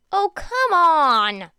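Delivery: high, loud, slow whine of protest; loud drawn-out complaint; exasperated protest. Acted. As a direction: drag it out in loud disbelief. Izuku protests being betrayed.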